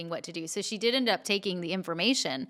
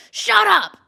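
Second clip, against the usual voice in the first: sharp, high, loud snapped shout; angry yell of "shut up"; aggressive shout; 13 semitones higher, 13 dB louder than usual. Retold or imitated. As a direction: bark it at her over your shoulder. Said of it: "Like, really aggressively like that".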